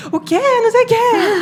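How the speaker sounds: very high, loud, drawn-out imitated outcry; overjoyed dad. Daughter imitating dad hearing her score, overjoyed. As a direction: cry out like a dad stunned by great news. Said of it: "Todo emocionado".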